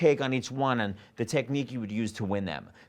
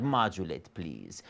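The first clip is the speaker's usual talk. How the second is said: request to the pianist; 9 semitones lower, 5 dB quieter, much slower than usual. Shown as a demonstration. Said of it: "totally allowed to do that".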